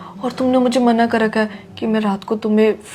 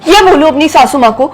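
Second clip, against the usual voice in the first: higher and louder, fed up; louder, exasperated girlfriend; exasperated scolding; 5 semitones higher, 5 dB louder than usual. Acted. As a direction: tell him to grow up. Scolding childish boyfriend.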